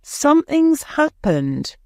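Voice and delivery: high-pitched urgent call; excited shout. A narrator's reading. Peter alerting the girls to trouble, shouting. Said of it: "shouted Peter".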